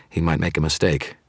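plain reading voice

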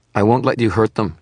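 high, loud protective snarl. A narrator's reading. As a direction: snarl like a mother defending her own. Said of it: "she snarled".

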